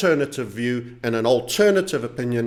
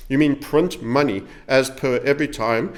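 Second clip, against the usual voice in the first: ordinary delivery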